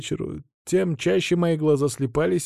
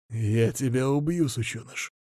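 menacing hiss; 5 dB quieter than usual. Acted. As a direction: hiss it through malice. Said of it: "злобно прошипел он".

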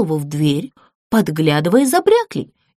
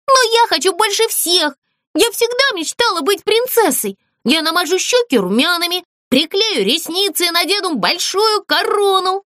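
insistent whining; 9 semitones higher than usual. Acted. Doll insisting on being princess.

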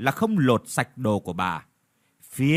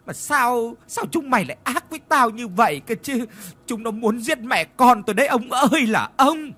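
high, loud tearful wail of a scheming wife; Vietnamese: vừa nói vừa khóc, giọng cao; crying while talking; 9 semitones higher, 4 dB louder than usual. Acted. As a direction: fake sobbing complaint to her husband, pitched high. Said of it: "vừa nói vừa khóc lóc".

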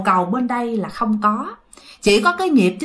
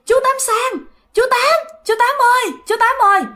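high-pitched, urgent calls to a neighbour; startled cries of recognition; calling out; 12 semitones higher than usual. Acted. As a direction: call his name over and over, voice leaping up.

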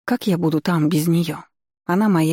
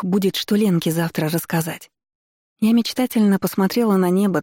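ordinary delivery

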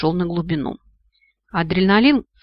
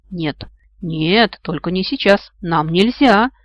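ordinary delivery

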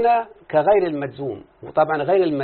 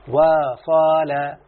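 وصال drawn out extremely slowly; slow demo; 3 dB louder, much slower than usual. Shown as a demonstration. Teacher slowly modelling وصال for the student. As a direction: stretch وصال out syllable by syllable. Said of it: "لما أقول مثلًا كلمة".

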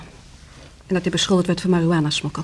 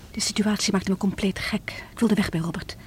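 hushed, softer whisper of despair; whispered confession; 5 dB quieter than usual. Acted. Widow admits to the detective why she fled, whispering. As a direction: confide it under her breath.

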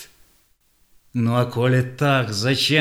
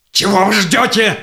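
higher, louder angry challenge; furious taunt; defiant shout; 7 semitones higher, 4 dB louder, faster than usual. Acted. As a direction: throw the dare at them. Sahei dares them to kill.

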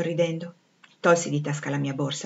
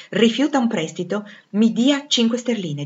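louder, higher, insistent haggling; indignant demand; 5 semitones higher, 6 dB louder than usual. Acted. Master asks Jane for a loan after refusal, indignant demand. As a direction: press the demand with raised voice.

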